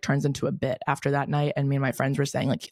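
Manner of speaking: ordinary talk, nothing marked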